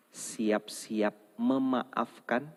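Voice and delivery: very slow, drawn out; slow lead phrase. Shown as a demonstration. Preacher leading the congregation in a slow chant. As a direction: stretch each word for the crowd to echo. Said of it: "Coba diulang".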